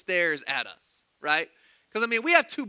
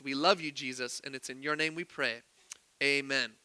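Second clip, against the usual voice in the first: quieter, lower prayer voice; 5 semitones lower, 4 dB quieter than usual. Real, not acted. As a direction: pray softly, settling lower toward the amen. Leads the congregation in prayer.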